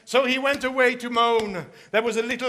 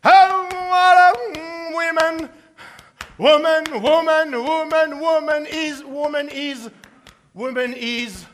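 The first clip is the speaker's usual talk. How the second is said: loud, higher grumbling lament; loud moaning rant; 4 semitones higher, 5 dB louder than usual. Acted. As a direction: whine at the world and the woman. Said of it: "off he went moaning".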